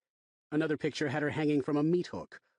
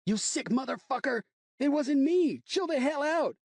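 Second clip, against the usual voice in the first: high, loud screaming match; frantic shouting; 14 semitones higher, 5 dB louder than usual. Acted. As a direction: yell over the fight. Brawl, screaming and defending.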